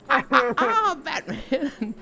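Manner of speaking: high, sly villain voice with a laugh; teasing, wagging-finger menace; creepy Joker. Retold or imitated. Joker quizzing Batman on legal age, high sly voice. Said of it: "And the Joker's like".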